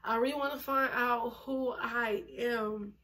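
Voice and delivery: usual speaking voice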